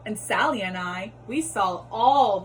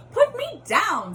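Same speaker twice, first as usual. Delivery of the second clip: high, slow squeal of a fish; shrill plea to be put down; fish cries out; 10 semitones higher, 4 dB louder, slower than usual. Acted. Fish begs the cat to put him down, high panicked cry. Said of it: "said the fish".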